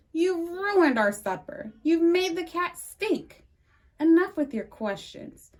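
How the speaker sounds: a frazzled dad lays down the law; exasperated scolding. A narrator's reading. Father scolds Ada for the stinky cat, exasperated.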